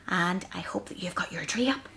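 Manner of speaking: soft whispered aside; whispering. Acted. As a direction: whisper it to the children.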